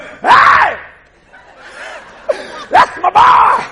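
very high, loud proud-father holler; God whooping from the throne; excited hollering. Acted. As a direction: burst out in a piercing, thrilled shout. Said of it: "he started hollering from the throne".

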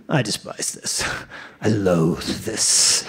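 low, slow groan of loathing; mock loathing. Gym-goer loathing the elliptical, acted low and slow.

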